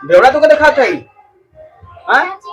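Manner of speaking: sharp, high, loud retort; angry challenge. Acted. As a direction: throw the question back, angry. Angry quarrel, husband challenges wife.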